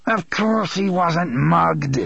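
slow, scornful snarl. Acted. Father scoffing at the mother, snarling. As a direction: spit it out dismissively. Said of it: "Elmer Chambers snarled".

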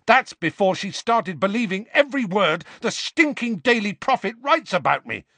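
high, loud bitter shout; top of his voice. A narrator's reading. Harry shouting at Ron and Seamus, high and loud. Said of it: "at the top of his voice".

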